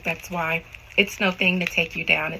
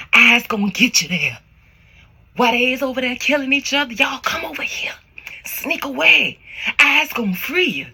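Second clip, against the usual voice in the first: raised, half-whispered urgent summons; lightly acted rescuer; 5 semitones higher, 3 dB louder than usual. Guide urging runaways to sneak away, acted urgent summons. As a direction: urge them away in a put-on rescuer's voice. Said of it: "telling them".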